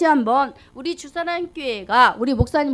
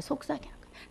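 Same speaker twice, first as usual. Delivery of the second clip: "속삭이는 거예요" breathed as a real whisper, much softer; 17 dB quieter than usual. Shown as a demonstration. Preacher showing the congregation what whispering means, whisper.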